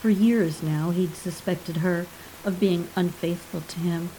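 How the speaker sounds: ordinary delivery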